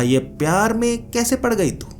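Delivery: high, sneering mock surprise; mock-sweet high voice. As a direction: tease her sudden sweetness. Mocking the witch's sudden sweetness.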